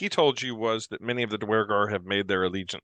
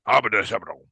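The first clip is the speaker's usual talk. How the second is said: loud mock-Duergar outburst; 4 dB louder, much faster than usual. Game master greets players as a Duergar woman, in Duergar voice. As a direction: bark out Duergar gibberish. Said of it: "That's my best Duergar voice".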